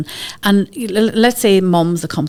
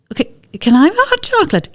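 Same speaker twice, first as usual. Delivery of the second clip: put-on high, fast, eager kid's plea; 11 semitones higher, 4 dB louder, faster than usual. Impulsive wee Charlie begging for hot chocolate, high and eager. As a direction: squeak it out like a keen wee boy. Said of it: "he goes".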